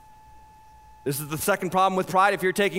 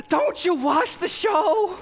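incredulous; 9 semitones higher, slower than usual. Retold or imitated. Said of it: "we look at each other like".

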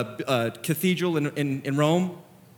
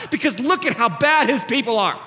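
emphatic, raised-pitch complaint; critic's indignation; 6 semitones higher, 4 dB louder than usual. Acted. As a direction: argue it like an indignant sceptic. Critic's version, contrasted with his own.

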